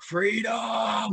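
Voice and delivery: high shouted battle cry; Braveheart cry. Retold or imitated. Friend quoting Braveheart's battle cry, high shout. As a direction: bellow it like a rallying warrior. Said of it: "you know, Braveheart".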